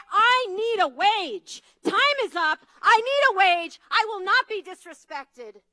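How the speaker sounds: voicing women who refuse to back down; defiant demand. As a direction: shout it like a picket-line demand.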